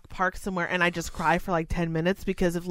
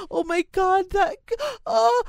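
much higher breathless mock outburst; overwhelmed gush; 13 semitones higher than usual. Sending up a big dramatic cry, high gushing outburst. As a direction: overplay being overcome with emotion. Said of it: "It's not like I'm".

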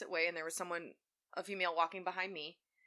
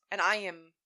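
ordinary talk, nothing marked

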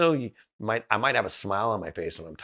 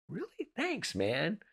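much higher, softer, caught off guard; a soft, startled thank-you; surprised and touched; 12 semitones higher, 8 dB quieter, slower than usual. Retold or imitated. Friend praises his honesty, softly surprised thanks.

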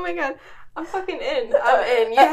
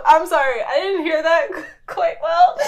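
ordinary talk

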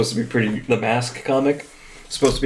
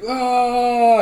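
excited yelp; 12 semitones higher than usual. Retold or imitated. Triumphant cry pulling out crab.

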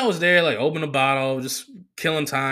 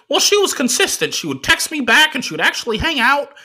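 much higher, louder put-on voice for the driver; imitating the driver; 6 dB louder than usual. Comic telling a crowd, mimicking his driver in a high excited voice. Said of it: "He's like".

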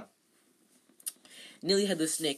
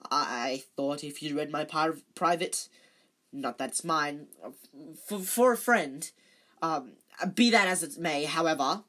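flustered caretaker; 6 dB louder than usual. Acted. Filch flustered, babbling excuses.

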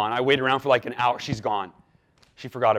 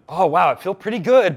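pleased with himself; 5 semitones higher, 9 dB louder than usual. Retold or imitated. Retold self-congratulation.

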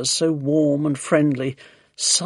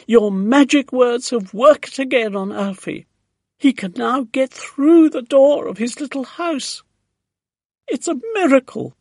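high-pitched, louder, thrilled; excited crying out; 8 semitones higher, 6 dB louder than usual. A narrator's reading. Neighbour shouts news of her tortoise, crying out excitedly. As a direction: call out the wonderful news, voice raised. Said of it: "she was crying".

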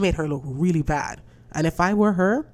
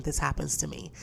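usual speaking voice

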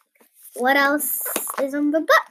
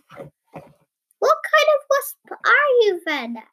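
high, slow questioning voice; curious jellyfish; 11 semitones higher, 3 dB louder, slower than usual. A narrator's reading. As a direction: ask it slowly up high. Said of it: "asked the Friday jellyfish".

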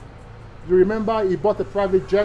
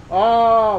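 normal talking voice

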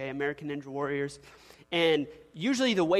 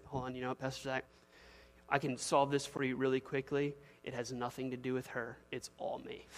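low, soft-spoken husband voice; imitated confessing husband; 4 semitones lower, 8 dB quieter than usual. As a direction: play a husband quietly owning the blame. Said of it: "the husband has looked at me and says".

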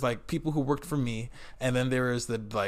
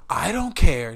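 louder, higher retort, imitating an aggressive boss; 6 semitones higher, 10 dB louder, slower than usual. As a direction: cut them off like a pushy boss. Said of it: "he was hyper aggressive".

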